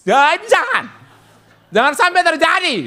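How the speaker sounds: put-on preacher joking to young people not to shorten his name; mock alarm. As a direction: cry out in comic protest. Playful warning to youths.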